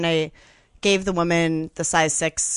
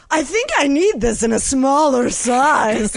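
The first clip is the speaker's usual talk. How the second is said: showing-off shopper; 7 semitones higher, 5 dB louder, slower than usual. Retold or imitated. Mocking coworker showing off.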